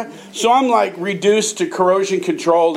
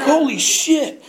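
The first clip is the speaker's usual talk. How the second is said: high, slow, laughing holy shit; laughing amazement; 8 semitones higher, slower than usual. Retold or imitated. Sailor hears he won the contest, laughing amazement. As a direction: savor the shock of winning.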